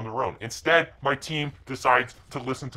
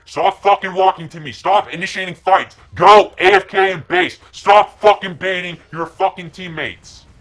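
very loud, higher screaming at a teammate; furious shout; 6 semitones higher, 11 dB louder than usual. Real, not acted. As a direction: scream him out of the fight. Screaming at Earth Spirit player.

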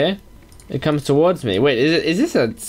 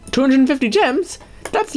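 high, astonished question; can't believe the amount; shocked; 9 semitones higher than usual. Real, not acted. Surprised by the gift of gems, high and astonished.

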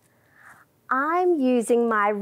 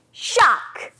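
loud shark; 4 semitones higher than usual. Shown as a demonstration. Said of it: "big loud shark".

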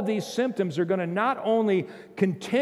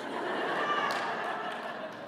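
breathy laugh; 8 dB quieter than usual. Real, not acted. Pastor laughs at own joke.

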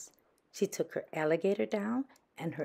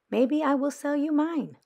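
higher, louder, coaxing offer; sly offer; 10 semitones higher, 6 dB louder than usual. A narrator's reading. Thelma teases Frances about the tea set, sly. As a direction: dangle the offer slyly.

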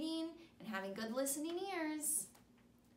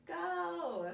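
slow, high, sing-song picture-book reading; animated storybook voice for young kids; reading to children; 4 semitones higher, much slower than usual. Acted. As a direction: read it big and bright for little listeners. Reads picture book to students.

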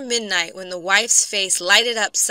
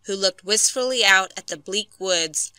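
ordinary delivery, nothing marked

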